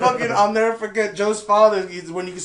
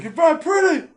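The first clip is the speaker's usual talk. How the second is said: high, loud caricature voice; imitated smitten blurting; 9 semitones higher, 5 dB louder than usual. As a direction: blurt it out like an awkward admirer. Acting out Peter's blurted compliment.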